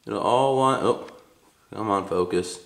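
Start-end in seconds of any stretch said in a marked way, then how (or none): none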